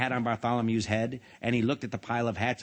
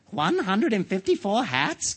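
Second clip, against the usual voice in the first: high, slow, astonished question; gulped disbelief; 8 semitones higher, 4 dB louder, slower than usual. A narrator's reading. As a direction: choke out the count in dismay. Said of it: "gulped Sir Alaric".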